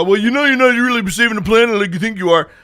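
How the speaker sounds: mock the critics' lecture; mocking critics. Owner imitating EV critics lecturing her, mocking. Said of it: "when people go".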